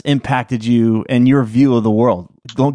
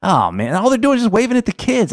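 mock teary voice, much higher; retold choked-up voice, pitched up high; imitating getting choked up; 12 semitones higher than usual. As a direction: replay the moment tears start, lightly. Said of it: "I'm like".

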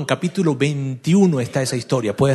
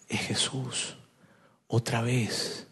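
near-whisper, lower and very soft; hushed realization; 4 semitones lower, 11 dB quieter, much slower than usual. Acted. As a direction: let it dawn slowly, barely aloud. (casi susurro de asombro)

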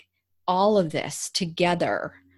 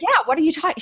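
mock indignant echo; 10 semitones higher, 7 dB louder, faster than usual. Guest echoes host's mock protest.

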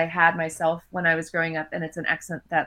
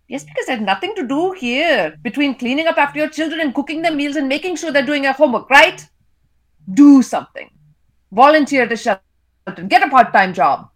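put-on raised, sarcastic Indian-accented quarrel; Indian parent's voice; 7 semitones higher, 7 dB louder than usual. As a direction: argue bitingly, getting louder on the short orders. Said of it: "I read in my characters' voices".

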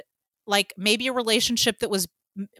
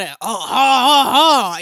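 mock French laugh; 2 semitones higher, 8 dB louder than usual. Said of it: "Pepe Le Pew accents".

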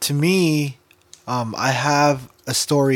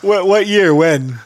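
laughing; 7 semitones higher, 6 dB louder, slower than usual. Real, not acted. Laughing question.

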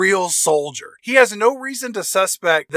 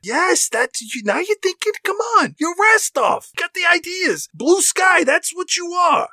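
excited hype shouting; 10 semitones higher than usual. Pretend. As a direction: pump the boss up, shouting high. Henchman hyping up his boss.

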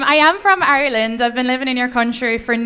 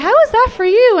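high, louder, hopeful asking; eager lover's question; 11 semitones higher, 6 dB louder than usual. Retold or imitated. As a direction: ask coyly in a raised pitch. Asks boyfriend after first night together.

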